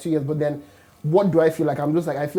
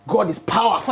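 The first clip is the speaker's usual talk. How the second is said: higher, emphatic mock-preacher proclamation; forceful preaching; 5 semitones higher, 4 dB louder than usual. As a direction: proclaim it like a fired-up church.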